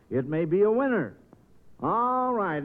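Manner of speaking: usual speaking voice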